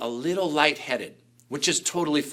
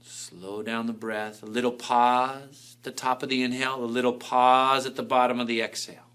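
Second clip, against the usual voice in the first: guided meditation; 3 semitones lower, 5 dB quieter than usual. Acted. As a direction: lull them into calm. Leads a meditation.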